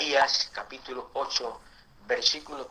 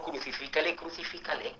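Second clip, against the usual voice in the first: a hostile cry whispered, much softer; whispered crowd cry; 2 semitones higher, 7 dB quieter than usual. Retold or imitated. Preacher voices the crowd turning on Jesus, whispered. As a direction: breathe the demand almost silently. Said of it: "La gente que dijo".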